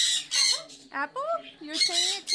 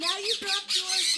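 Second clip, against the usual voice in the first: ordinary talk, nothing marked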